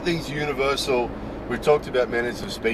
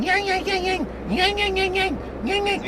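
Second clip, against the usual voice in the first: very high yapping little dog barks; yappy dog. Retold or imitated. Vlogger imitating the neighbour's small dog yapping, very high. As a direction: yap like a tiny Fifi. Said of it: "you get the, the dog going".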